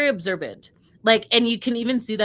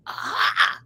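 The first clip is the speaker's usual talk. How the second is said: sharp breathy gasp of outrage; a quick imitated gasp; mock shocked gasp. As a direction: gasp as if scandalised. Retold shocked reaction.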